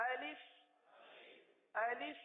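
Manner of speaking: الف with two short vowels, "a-lif"; single L and short "i" in الف; correct alif pronunciation. Shown as a demonstration. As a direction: model a short, clean الف. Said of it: "الف کو اس طرح پڑھیں گے".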